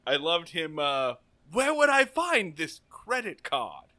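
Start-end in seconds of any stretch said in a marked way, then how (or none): 1.5-3.9 hammy quote. Retold or imitated. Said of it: "hammy minute of that".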